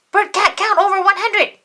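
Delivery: high, loud bursting blurt. A narrator's reading. Ernie bursting with secret.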